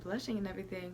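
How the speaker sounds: ordinary talk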